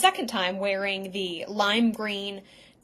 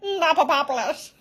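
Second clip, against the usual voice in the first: laughing name echo; 4 semitones higher than usual. Real, not acted. Echoing the funny name.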